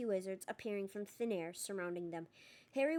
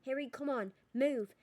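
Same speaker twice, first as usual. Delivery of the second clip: loud, high, urgent call; urgent shout; 4 semitones higher, 5 dB louder than usual. A narrator's reading. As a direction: drag him away with your voice. Hermione urging him to flee.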